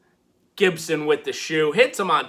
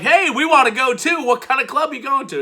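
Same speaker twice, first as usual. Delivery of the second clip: eager tagalong; 9 semitones higher, 3 dB louder, faster than usual. Retold or imitated. Everrise eager to join.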